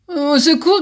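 high shrill yelp for help. A narrator's reading. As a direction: yelp in panic. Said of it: "glapit-il".

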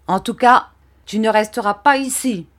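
high, loud, rough order; rough anger. A narrator's reading. Barberin grabs the boy by the ear, angry. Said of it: "en me prenant rudement par l'oreille".

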